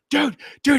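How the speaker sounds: high, loud imitation of a buddy yelling over the mic; mock excited yell. Retelling a teammate yelling into his mic, high loud mock yell. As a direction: yell it like you're hyped mid-battle. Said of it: "Cole screaming at the top of his lungs".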